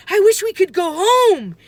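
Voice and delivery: high, loud desperate shout. A narrator's reading. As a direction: shout the wish to get away. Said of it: "he shouted".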